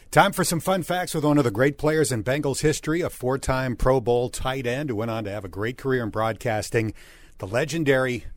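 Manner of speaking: a lightly hyped announcer read building up a guest; announcer intro. Acted. Host to listeners introducing the guest, light announcer hype. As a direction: bring the guest on like a show host. Fun facts segment intro.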